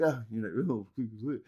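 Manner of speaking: slow, low mock voice of Ali; lazy dismissive mockery. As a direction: sneer it out like a limp apologist. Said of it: "he's there going".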